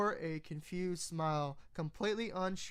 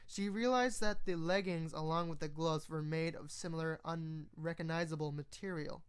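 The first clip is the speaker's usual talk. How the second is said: ordinary delivery, nothing marked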